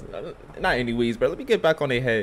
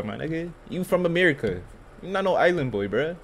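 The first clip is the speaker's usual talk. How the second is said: ordinary talk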